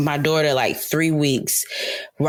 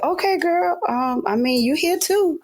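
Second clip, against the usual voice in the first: high-pitched cheering on; playful high hype voice; hyped-up; 13 semitones higher than usual. Retold or imitated. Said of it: "And I was like".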